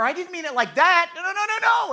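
high, loud, panicky take-back; flustered backpedaling. Retold or imitated. Peter to those he offended, flustered backpedaling. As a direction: scramble to undo a blunder.

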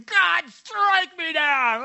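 high, slow, shouted defiance; hammed-up defiant yell; mock scream. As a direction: yell it up at the sky, mocking him. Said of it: "he's screaming".

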